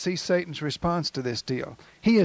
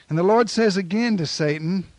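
normal talking voice

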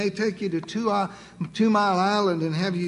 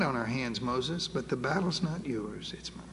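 reassuring God; 6 semitones lower, 8 dB quieter than usual. Acted. God reassuring Moses in the fight.

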